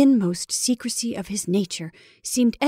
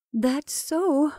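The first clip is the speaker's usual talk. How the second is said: high, timid agreement; scared, feigning natural; 7 semitones higher than usual. Acted. Mrs Brigham humouring Henry, scared. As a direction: try to hide the fear. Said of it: "in a scared voice which she tried to make natural".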